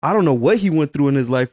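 ordinary talk, nothing marked

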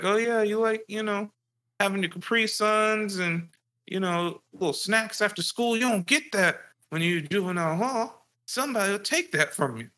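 high sneering imitation of dad; mocking dad. Dad describing juvenile hall to his kid, high and mocking. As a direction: taunt like a mocking father. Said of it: "He's like".

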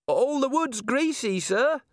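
very high, strained squeal; panicked dwarf. A narrator's reading. Dwarf dangling from the candle line, high panicked voice. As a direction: squeak it out, barely holding on.

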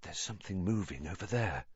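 hushed, quick undertone; breathed. A narrator's reading. Harry warning Ron of movement nearby, breathed. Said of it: "Harry breathed".

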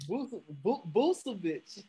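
Vucevic stumbled over, with a lightly voiced Kendrick Perkins stammer; mock stumble like a TV analyst fumbling the name; Kendrick Perkins impression. Host jokingly imitates Kendrick Perkins fumbling the player's name. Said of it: "I'm trying to do Kendrick Perkins".

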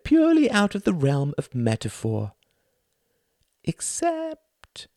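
high, showy stage-host voice; theatrical thin man. Acted. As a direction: play the pompous thin performer lecturing the audience. Thin man addresses audience.

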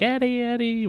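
high-pitched imitation of yelling; mock yell. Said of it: "yelling, like".

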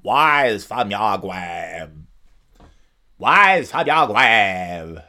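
put-on slow, booming old-game wizard, R dropped; arcade wizard voice. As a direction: intone it slowly like a retro game. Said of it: "a fucking wizard guy who's saying".

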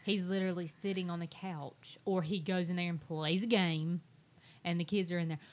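ordinary talk

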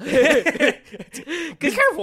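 high, slow squeaky cartoon mouse; Mickey Mouse voice. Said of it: "in a Mickey Mouse voice".

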